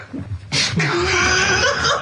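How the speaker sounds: a sudden high shriek of laughter; real uncontrollable laugh; high burst of laughter. Real, not acted. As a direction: lose it completely. Bursts out laughing mid-attempt.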